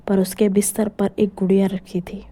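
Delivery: usual speaking voice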